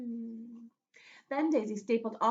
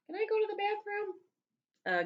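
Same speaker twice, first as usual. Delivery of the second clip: put-on pleading kid; 11 semitones higher, slower than usual.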